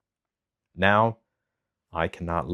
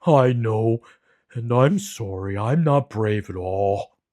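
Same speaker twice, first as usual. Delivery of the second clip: slow, loud, sorrowful lion voice; a big cat owning his shame; sad lion; 3 semitones higher, 4 dB louder, slower than usual. A narrator's reading. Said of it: "the lion said".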